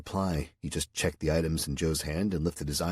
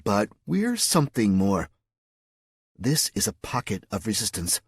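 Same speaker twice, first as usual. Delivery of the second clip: higher, eager conspirator voice; Mary Lou; 5 semitones higher, 5 dB louder than usual. Acted. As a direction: proudly reveal the secret.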